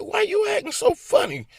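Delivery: very high quizzical wife voice; wife's question. Retold or imitated. Wife asking her husband, high and puzzled. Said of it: "She said".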